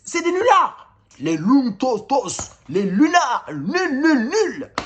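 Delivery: high-pitched, indignant outburst; exasperated rant. Real, not acted. Fan trashing his team's useless players, exasperated and high-pitched. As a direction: rail at them, voice jumping high. Ranting at players he wants sacked.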